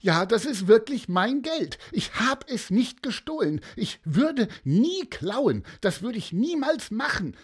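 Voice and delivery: put-on high, tearful child's plea; high-pitched, distressed insistence; sobbing protest. A narrator's reading. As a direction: plead innocence as an upset little boy. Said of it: "schluchzte Armin".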